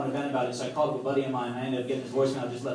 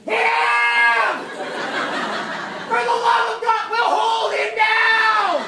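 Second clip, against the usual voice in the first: very high, full-out exasperated shout; loud, high, desperate yelling; crowd yelling; 7 dB louder, much slower than usual. Acted. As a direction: scream it like a fed-up onlooker egging on a parent. Onlookers yelling at exasperated parent.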